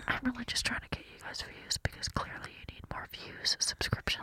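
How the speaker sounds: hushed, unvoiced whisper. Real, not acted. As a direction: lean in and whisper it to your cohost. Whispers a teasing reply to cohost.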